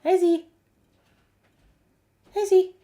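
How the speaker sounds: high, loud question to a dog; excited pet talk. Real, not acted. Asking the dog where it is, high pet talk.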